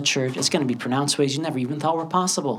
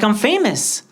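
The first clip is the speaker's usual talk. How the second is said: excited; 7 semitones higher, slower than usual. Acted. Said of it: "I was so excited to tell my parents".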